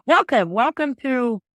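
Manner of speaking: loud, high scripted welcome. Retold or imitated. Greeting a customer, in a loud scripted welcome. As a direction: rattle off the canned greeting. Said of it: "difference between saying welcome".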